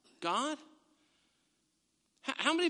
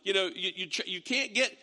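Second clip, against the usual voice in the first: normal talking voice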